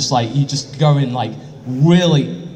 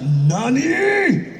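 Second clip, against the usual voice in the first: mock surprise; 10 semitones higher than usual. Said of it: "As in, like".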